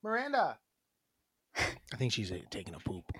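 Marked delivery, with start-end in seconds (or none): 0.0-0.6 high call across the house. Real, not acted. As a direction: call her name from afar. Calling partner from another room.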